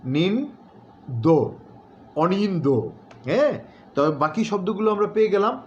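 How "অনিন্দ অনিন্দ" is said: অনিন্দ drawn out slowly, ending with a dental দ followed by an o; pronunciation demo. Shown as a demonstration. Teacher repeating অনিন্দ slowly for students. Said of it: "ও এর মতো হবে".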